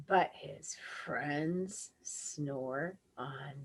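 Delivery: soft, slow hush. A narrator's reading. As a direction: lull them to sleep. Quiet ending refrain.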